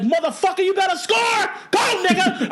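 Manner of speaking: excited fan shouting at the television; yelling at the TV. Retold or imitated. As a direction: yell it like the game is on the line. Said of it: "I was yelling at the T V".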